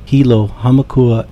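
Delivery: ordinary delivery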